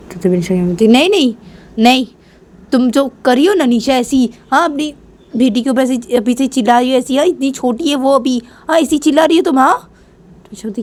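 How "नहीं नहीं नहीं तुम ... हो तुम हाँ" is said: high, agitated reproach; acted raised-voice reprimand; scolding. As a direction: tell off a daughter in dismay. Grandmother scolds her for shouting.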